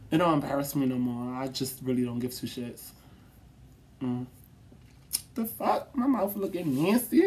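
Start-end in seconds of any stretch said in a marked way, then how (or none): none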